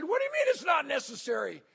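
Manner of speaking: high, insistent comeback; indignant disbelief. Retold or imitated. Traveler insisting on giving up his seat, indignant disbelief. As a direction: push back in mock indignation. Insisting on swapping seats with soldier.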